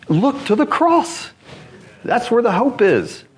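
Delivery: high-pitched, fervent gospel appeal; impassioned preaching. Real, not acted. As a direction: build to a ringing pulpit plea, stretching key phrases. Preaches the cross at full force.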